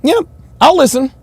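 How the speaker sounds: high, smiley mock agreement; smiling imitation. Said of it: "look at the smile".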